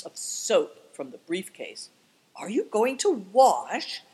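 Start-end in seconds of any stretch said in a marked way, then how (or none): 2.3-4.0 higher, slow alarmed question; a boy startled at the thought of washing. A narrator's reading. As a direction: ask in sudden alarm.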